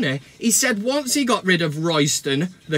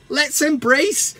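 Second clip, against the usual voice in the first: usual speaking voice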